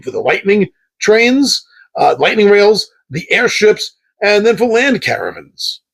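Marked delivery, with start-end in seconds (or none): none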